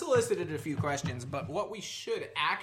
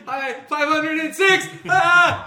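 high sung-out shout; laughing shout; 13 semitones higher, 17 dB louder, slower than usual. Real, not acted. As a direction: belt it out, laughing. Shouted number, then yell.

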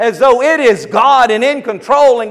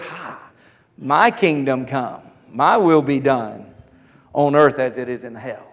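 low, quiet gloating devil voice; mock Satan; 10 semitones lower, 5 dB quieter than usual. Preacher voicing Satan's mock prayer, a low gloat. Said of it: "and Satan says".

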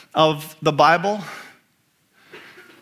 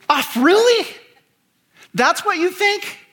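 much higher, mocking sneer on really; sarcastic snark; 13 semitones higher than usual. Preacher voicing Satan mocking a truthful friend, sarcastic. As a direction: church lady sarcasm, dripping with doubt. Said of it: "Do you hear the sarcasm?".

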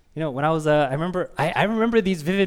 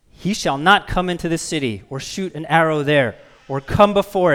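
loud, measured proclamation; emphatic preacher reading a prophecy aloud; forceful scripture reading; 4 dB louder than usual. Acted. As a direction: declare the Lord's decree to the congregation.

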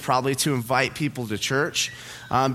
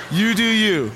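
sassy retort; 6 semitones higher, 5 dB louder, slower than usual. Retold or imitated. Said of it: "And I was like".

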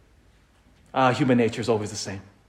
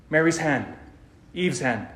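ordinary talk, nothing marked

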